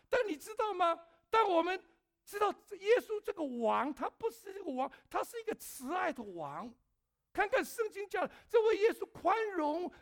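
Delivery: loud, high sermon delivery with shouted peaks; impassioned preaching. Acted. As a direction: preach it hard to the congregation. Preaching at full force.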